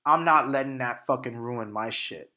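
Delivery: ordinary talk, nothing marked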